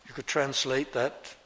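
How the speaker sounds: ordinary delivery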